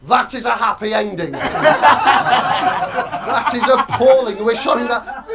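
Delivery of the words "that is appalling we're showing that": very slow, stretched-out appalled line; drawn-out, laughing mock horror; mock outrage. Real, not acted. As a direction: drag out the disgust for laughs. Comic outrage at the story.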